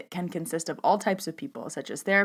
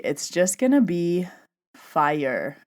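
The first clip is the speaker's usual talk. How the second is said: ordinary delivery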